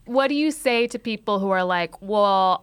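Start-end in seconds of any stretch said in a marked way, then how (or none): none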